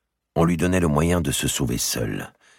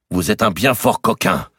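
a raised, higher outcry; exclaimed; 7 semitones higher, 6 dB louder than usual. A narrator's reading. The archbishop cries out at the merchant, raised voice. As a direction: fling the insult at him, louder. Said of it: "s'écria Jean de Marigny".

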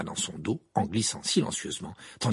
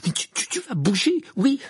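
high, stammering, loud outburst; frustrated yell; exasperated shouting; 6 dB louder than usual. A narrator's reading. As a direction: lose patience and yell, tripping on words. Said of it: "on se mettait à crier".

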